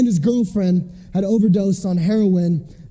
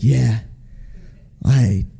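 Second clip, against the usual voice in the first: low, drawn-in voice of a hooked listener; mock-enraptured low murmur; blissed-out conviction junkie; 10 semitones lower than usual. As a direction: drop low, savoring the guilt. Acts himself relishing convicting sermons.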